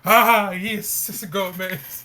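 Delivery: lightly put-on Spanish accent as Gomez. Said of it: "I can't do a Spanish accent".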